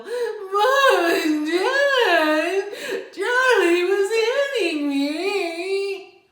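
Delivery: high, loud, drawn-out whine with a crying laugh; whining. Shown as a demonstration. Child whining to mom and dad about Charlie. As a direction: stretch every word into a nasal-free childish whine. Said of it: "stop the whining".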